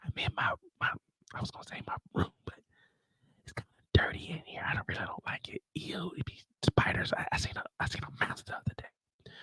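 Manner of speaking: hushed whisper. Retold or imitated. Drew on the jail phone to Diana, whispering.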